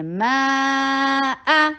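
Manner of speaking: ما آ recited slowly with a clear hamza break; slow recitation demo; separated alif and hamza. Shown as a demonstration. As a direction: keep ما and آ apart. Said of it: "الف اور حمزہ ٹو different sounds ہیں".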